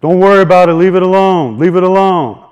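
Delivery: calling out from a distance; mock holler up the lane; retold holler. Coworker hollering down to him, loud call. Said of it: "coworkers hollering from the top".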